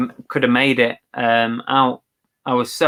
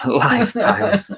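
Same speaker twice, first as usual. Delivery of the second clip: high, drawn-out words through laughter; laughing; 9 semitones higher, slower than usual. Real, not acted. Co-host echoing a viewer's joke, laughing. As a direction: repeat it while cracking up. Amused echo of chat's joke.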